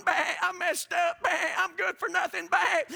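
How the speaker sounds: lightly bleating sheep. Acted. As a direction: sound like a sheepish lamb owning up, lightly bleated. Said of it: "I can just see the sheep going".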